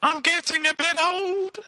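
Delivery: sing-song auto-tuned robot voice; auto-tuned falsetto. Pretend. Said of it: "what's wrong with your voice".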